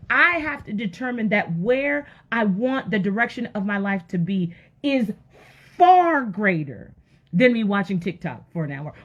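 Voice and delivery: ordinary talk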